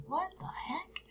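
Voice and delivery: high, hushed disbelief; taken-aback reaction. Acted. To a friend who is suddenly yelling, high and quietly bewildered. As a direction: squeak it softly, as if baffled. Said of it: "and you're like".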